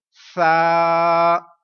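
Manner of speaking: सा held slow for one second, no whistle; teacher drawing out सा with no whistle; drawn-out sa. Shown as a demonstration. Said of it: "one second लंबा भी करना है".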